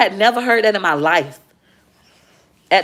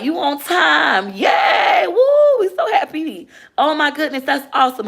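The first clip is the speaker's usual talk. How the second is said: high, excited cheering voice; office cheering; 10 semitones higher than usual. Retold or imitated. School office staff cheering for her, high excited shout. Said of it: "They was like".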